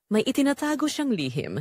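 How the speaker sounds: ordinary talk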